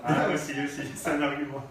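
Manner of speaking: loud, laughing reply; amused agreement. Real, not acted. Militant answering a colleague's teasing aside, amused and laughing. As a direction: concede it jokingly, ending in a laugh.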